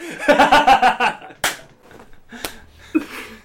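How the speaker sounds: high, loud burst of laughter; cracking up. Real, not acted. Film fan cracking up at their own impression, laughing hard. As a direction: break into helpless real laughing.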